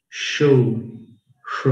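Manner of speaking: normal talking voice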